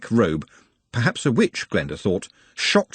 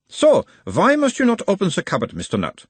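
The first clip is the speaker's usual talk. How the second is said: put-on a mild Uberwaldian accent, the higher voice of a gentle examiner; 10 semitones higher, 3 dB louder than usual. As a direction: question him in a light Uberwald accent, calming the patient. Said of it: "in a mild Uberwaldian accent".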